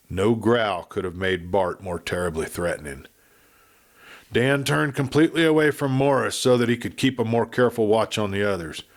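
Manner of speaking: ordinary delivery, nothing marked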